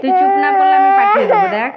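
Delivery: high, loud and fast furious threat; angry shout. Real, not acted. Threatening crying child. (রেগে চিৎকার করে হুমকি)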